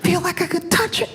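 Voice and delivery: whispery, high-pitched awe; hammed-up breathless amazement; imitating excited girlfriend. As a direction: gasp out each sight in mock wonder. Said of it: "see how excited she is".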